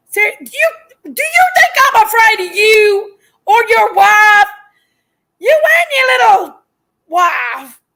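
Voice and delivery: loud, defiant yelling; angry shouting. Real, not acted. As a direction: shout back in raw anger. Shouting back at a rival.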